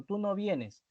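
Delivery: "no" 에 힘을 주어 강조; 부정어 no를 톤을 올려 강하게; stress on no. Shown as a demonstration. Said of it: "le quieres decirte el no, enfatizas".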